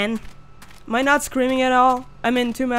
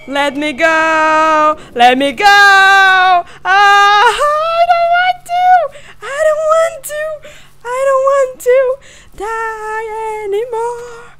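1 desperate screaming; 10 semitones higher, 7 dB louder, slower than usual. Real, not acted. Chased in horror game.

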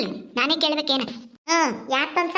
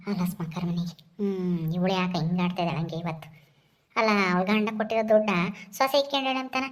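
put-on low, soft old woman muttering to herself; grumbling granny; 9 semitones lower, 5 dB quieter than usual. Turned-away old woman grumbles about her neighbour, low and soft.

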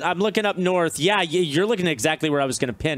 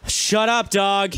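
mock insult; 5 semitones higher, 3 dB louder, slower than usual. Friends trading a mock insult.

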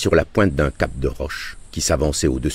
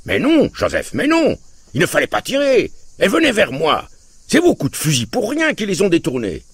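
bad-tempered shouting; 14 semitones higher than usual. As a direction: bellow at Joseph in irritation. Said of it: "il criait sur un ton de mauvaise humeur".

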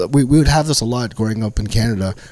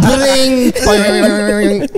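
imitated phone beeps; 8 semitones higher than usual. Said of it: "It's like".

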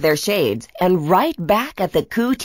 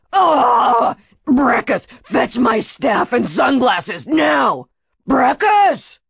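loud, raised cry of frustration; exasperated villain; 4 semitones higher, 3 dB louder, slower than usual. Acted. Kunatos, fooled by a cutout, yells for Brachus, exasperated. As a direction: shout impatient orders at your henchman. Tricked villain orders his henchman.

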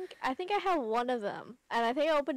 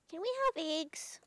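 put-on childlike voice; 4 semitones higher, 4 dB quieter than usual. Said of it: "game voice acted by [name] and [name]".

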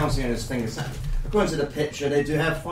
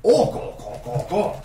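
orc grunting; 4 semitones higher than usual. Acted. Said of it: "The orcs are like".

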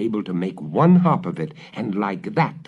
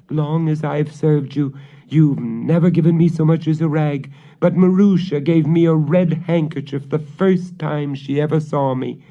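put-on servant girl's complaint; 7 semitones higher, 5 dB louder than usual. Servant girl answers witch.

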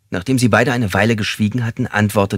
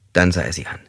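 usual speaking voice

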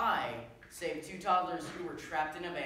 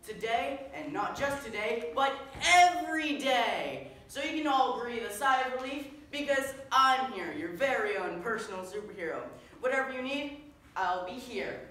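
bombastic superhero; 7 semitones higher, 8 dB louder than usual. Acted. Superhero reassuring everyone they can relax, bombastic. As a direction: proclaim it to the whole crowd. Plays a self-styled superhero.

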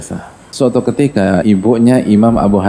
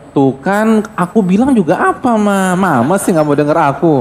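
higher, petulant told-you-so at Mama; told-you-so son; 6 semitones higher than usual. Acted. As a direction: needle Mama like a sulky grown son. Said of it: "Abu Hanifah nggak bilang".

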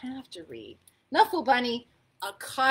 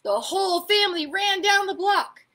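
loud, high, frantic narration; urgent storytelling; 6 semitones higher, 9 dB louder than usual. A narrator's reading. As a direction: race to the rescue as you read. Narrates the frantic run back.

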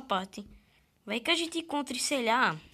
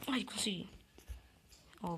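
normal talking voice

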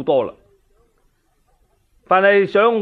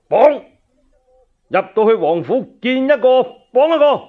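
higher, louder shouted order to bind everyone; barked command; 7 semitones higher, 3 dB louder than usual. Acted. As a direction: roar the arrest order at the troops. Commander orders soldiers to seize all.

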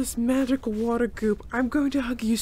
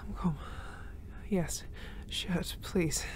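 "I'm calm" said softer and lower, breath settling; 7 semitones lower, 11 dB quieter, much slower than usual. Acted. Calming herself after the rescue, softer self-soothing murmur.

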